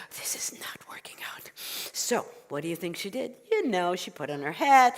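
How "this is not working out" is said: breath-only, much softer complaint; weary despair. Acted. Old woman kept awake by animals, whispered despair. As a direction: whisper it as if worn out.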